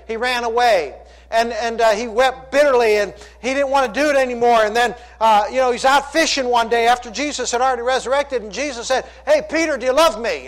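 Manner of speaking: loud, high-pitched Bible storytelling from the pulpit; impassioned preaching. Acted. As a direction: drive the story at full force. Preaches Peter story at full force.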